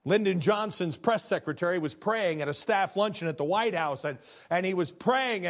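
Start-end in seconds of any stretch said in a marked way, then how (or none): none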